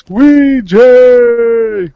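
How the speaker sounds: loud, high self-introduction; excited announcement. Real, not acted. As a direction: burst in announcing yourself. Guest announces himself loudly.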